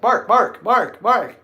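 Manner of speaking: high, loud rapid-fire name calling; repeated calling. Retold or imitated. Calling Mark near VIP, high insistent shouts. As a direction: pester him with his name, loudly. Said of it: "and I'm like".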